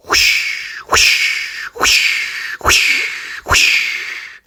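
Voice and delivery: breathy unvoiced whoosh sounds. A narrator's reading. Narrator voicing firefighters sliding, breathy whoosh. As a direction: blow the whoosh out.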